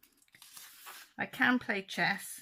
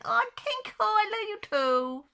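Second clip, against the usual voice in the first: much higher, slow, swooning reply; lovestruck girl gnome; 13 semitones higher, 8 dB louder, slower than usual. Pretend. Girl gnome answering her sweetheart, swooning and high. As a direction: sigh it back like a sweetheart. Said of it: "And she's saying".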